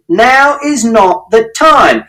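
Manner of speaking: slow, high, firm call; stern shout. A narrator's reading. Mister Shears shouting at the parents to stop. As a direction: stop them, deliberately. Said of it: "And Mister Shears shouted".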